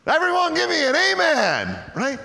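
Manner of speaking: high, loud call for an amen; rousing call. Real, not acted. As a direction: fire up the crowd. Preacher calls on congregation.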